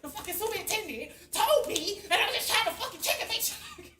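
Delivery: loud, high furious rant; going off. Shown as a demonstration. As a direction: show how hard you went off, yelling. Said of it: "I'm literally going off like this".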